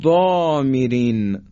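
ضامرین with thick dark ض and long rounded "aw"; slow, full-mouthed ضامرین, ضا backed and drawn out; heavy tafkhim. Shown as a demonstration.

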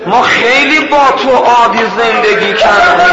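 high, loud imploring plea, slowing on ببخش منو; pleading wife. Retold or imitated. Wife clings to her husband and begs forgiveness, high and loud. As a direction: beg him to forgive, half in tears.

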